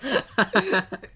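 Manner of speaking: forced hammy laugh; fake laugh. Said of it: "That sounds so fake".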